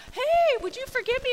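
high, loud call-out; mock eager apology. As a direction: pipe up as if catching someone in passing. Acts out someone walking up.

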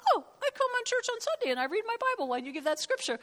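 high, fast mock excuse; mocking churchgoer. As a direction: rattle it off in a silly high voice. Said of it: "mocking churchgoer's excuse".

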